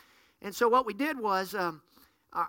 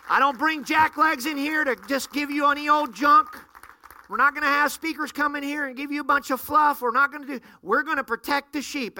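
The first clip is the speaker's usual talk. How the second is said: louder, higher preacher's cry; preaching at full force; 5 semitones higher, 5 dB louder than usual. Acted. Pastor preaching protection to his church, loud and high. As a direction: drive it home to the flock.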